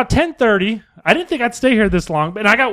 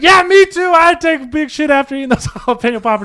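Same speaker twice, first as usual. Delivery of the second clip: mock Christina voice; 6 semitones higher, 6 dB louder than usual. Voicing Christina's text reply.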